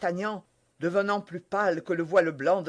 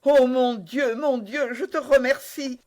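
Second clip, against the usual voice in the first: grateful; 7 semitones higher, 6 dB louder than usual. Acted. D'Artagnan thankful.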